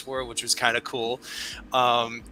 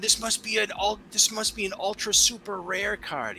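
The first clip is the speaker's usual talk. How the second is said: put-on higher, childlike and excited; imitating his son; 11 semitones higher than usual. A dad imitating his son's high, excited gushing over a rare card. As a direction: gush like a little boy.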